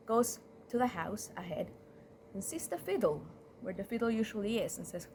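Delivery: plain reading voice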